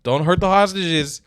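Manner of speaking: loud, high mock Trump rant; imitating Trump. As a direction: bark it like an impatient president. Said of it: "Trump was like".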